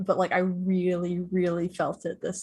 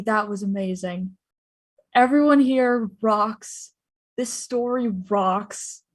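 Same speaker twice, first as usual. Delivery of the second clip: normal talking voice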